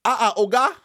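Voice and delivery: light imitation of a Nigerian elder; Nigerian accent. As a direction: toss off "Ah, ah, Oga" in a mock Nigerian accent. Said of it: "that's my Nigerian accent".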